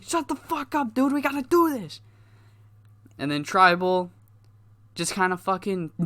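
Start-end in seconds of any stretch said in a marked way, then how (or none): 0.0-2.0 high, irritated shut-up at his partner; snapping back. Retold or imitated. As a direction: tell your buddy to shut up and focus. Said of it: "Java's like".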